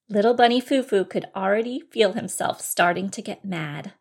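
plain reading voice

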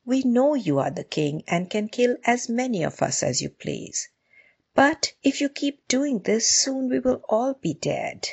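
a hint of worried animal subjects; pleading animals. A narrator's reading. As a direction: reason gently with the king, lightly in character.

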